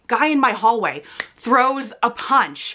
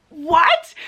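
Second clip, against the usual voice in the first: shocked what; 7 semitones higher, 3 dB louder than usual. Retold or imitated. Hearing about a fist fight.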